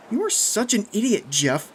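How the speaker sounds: loud, high-pitched scolding; acid. A narrator's reading. Kathy to Jeff after his prank, acidly. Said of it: "Kathy said with acid".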